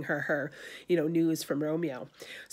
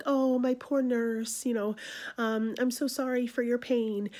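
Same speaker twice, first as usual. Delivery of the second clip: sympathetic Juliet; 6 semitones higher than usual. Retold or imitated. Juliet soothes the aching nurse to butter her up. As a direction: coo over the poor nurse, half sincere. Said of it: "Juliet really does have concern for the nurse".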